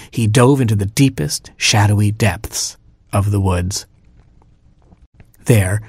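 ordinary delivery, nothing marked

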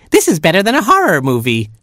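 very high squeaky little chick; a tiny baby chick whining. Acted. Baby chick complaining to parents, very high squeak.

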